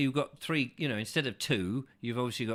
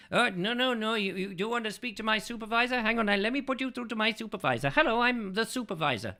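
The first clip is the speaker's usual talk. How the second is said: high, hurried mock Indian accent; 8 semitones higher than usual. Scam caller passes host to supervisor, mock Indian accent. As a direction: insist eagerly in a put-on Indian accent. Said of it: "same person with the same accent".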